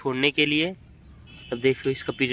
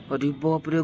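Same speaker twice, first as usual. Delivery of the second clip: normal talking voice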